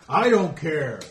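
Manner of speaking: loud high defiant yell; defiant shout. Acted. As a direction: throw it back in his face. In-character standoff between ogres.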